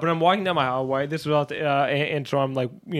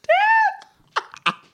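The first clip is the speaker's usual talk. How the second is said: squeaky falsetto of his younger self; high-pitched bitch voice; 3 dB louder than usual. Retold or imitated. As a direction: call for dad in a tiny, very high voice. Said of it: "a high pitched fucking bitch voice".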